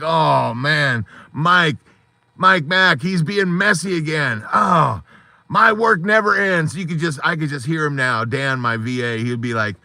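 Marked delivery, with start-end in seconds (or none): 0.0-6.8 high-pitched mock complaint; imitated exasperated assistant. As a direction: moan in exasperation as the overworked assistant. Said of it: "and he's like".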